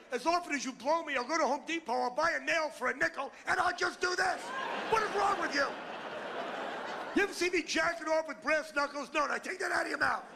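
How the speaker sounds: high-pitched, exasperated tirade; outraged rant. Acted.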